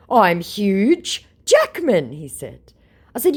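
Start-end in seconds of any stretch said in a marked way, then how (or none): 0.1-2.1 loud, high, very drawn-out introduction; mock-grand announcement. Fake celebrity introduces himself to a fan, mock-grand. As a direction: announce the name slowly, hammed up.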